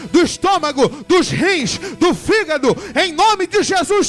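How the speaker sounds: normal talking voice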